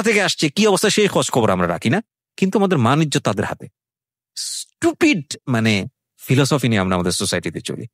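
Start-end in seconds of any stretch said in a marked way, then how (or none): 4.4-5.4 drawn-out, scornful stupid, pitched high. Real, not acted. Host scorning society's worry about neighbours, a drawn-out high sneer. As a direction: spit out stupid slowly, high and mocking.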